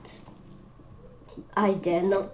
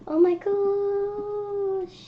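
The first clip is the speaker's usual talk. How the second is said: slow, much higher drawn-out gasp; amazed; 11 semitones higher, much slower than usual. Real, not acted. Child marvelling at the hen tower, slow high amazement. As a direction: stretch it out in wonder.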